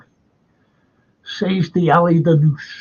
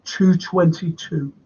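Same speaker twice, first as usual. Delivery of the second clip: ordinary delivery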